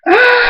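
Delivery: very high, loud sustained shriek; drawn-out high scream; long scream. A narrator's reading. Thunderstorm buried under wolves, long screaming. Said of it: "Thunderstorm screams".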